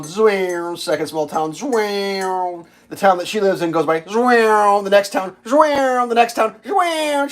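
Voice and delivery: rising whoosh zwing for each town; whooshing sound effect. Retold or imitated. Train rushing past towns.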